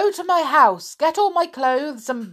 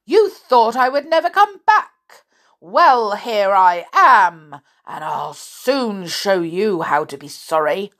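put-on angry old woman; 2 semitones higher, 6 dB louder than usual. Old woman catching her children, loud angry cry. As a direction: tear into them like a whirlwind. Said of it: "she cried".

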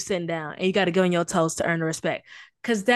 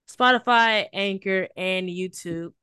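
ordinary talk, nothing marked

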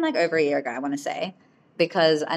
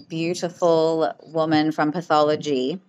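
normal talking voice

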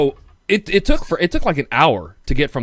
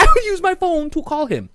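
exasperated; 3 dB louder than usual. Real, not acted. Rant continues high.

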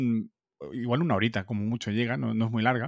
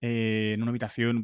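usual speaking voice

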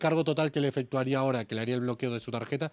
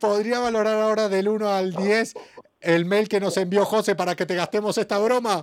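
high, loud reveal with laughter; spring the prank, laughing, high and loud; delighted prankster breaking character, laughing; gleeful reveal; 5 semitones higher, 5 dB louder than usual. Real, not acted.

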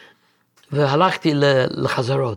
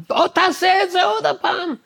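mock yell, much higher; hammed-up demanding teacher shouting at him; impatient musician yelling "do it again"; imitating a shout; 13 semitones higher than usual. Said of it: "מתחיל לצעוק עליי".

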